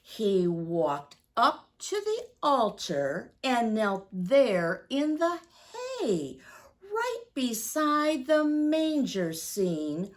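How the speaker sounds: storybook rhyme read with slow, lingering phrases; reading to children. Acted. Reads Santa's Prayer to the children, storybook voice. Reads picture book aloud.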